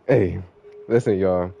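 ordinary talk, nothing marked

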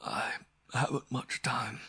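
slow, choked, breathy croak; laboring croak. A narrator's reading. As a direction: croak it out with little voice. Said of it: "his voice was a laboring croak".

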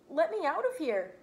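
urgent cry. A narrator's reading. Rabbit's thought voiced loudly.

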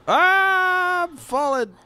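high, drawn-out cry; falling cry. Real, not acted. Player falling in the game, drawn-out high cry. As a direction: stretch it out high as you drop.

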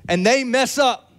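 mild meme catchphrase; a touch of the "mess up" meme. Retold or imitated. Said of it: "A-, Aaron".

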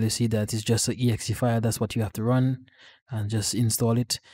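ordinary delivery

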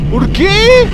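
high, loud drawn-out wail; anguished scream. Real, not acted. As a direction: scream the question in despair. Screams at the game's twist. (grito agudo de desesperación)

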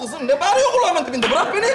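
high, irritated outburst; exasperated protest. Acted. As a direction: push them away with your voice. Protests at wailing in his ear.

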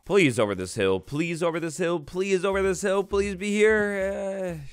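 hushed begging that climbs steeply in pitch; building plea. Real, not acted. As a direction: plead under your breath, rising to a drawn-out end. Pleading for land beyond the hill.

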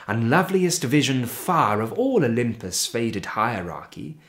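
ordinary delivery

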